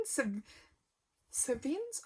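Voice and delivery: "Cevennes" tried twice, rising and very soft; a quiet, questioning "Cevennes?"; unsure pronunciation. Real, not acted. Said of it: "I don't know how you pronounce it".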